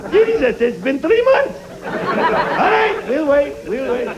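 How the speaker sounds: high, laughing mock Arabic accent, slow; mock Arabic accent, resigned; mock Iraqi accent. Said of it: "Iraqi accent".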